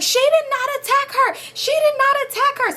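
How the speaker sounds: high squeaky mock voice, insisting; mocking shrill denial. Mimicking the mother denying to police, high squeaky voice. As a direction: squeak it out like a frantic accuser.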